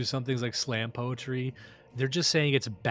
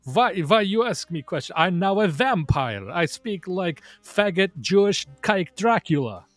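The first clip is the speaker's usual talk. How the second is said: higher, louder mock Eastern European accent, halting; Borat Zizek voice; 6 semitones higher, 5 dB louder than usual. Host mocking the writer's stuttering podcast interviews, loud Eastern European accent. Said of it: "Borat Zizek voice".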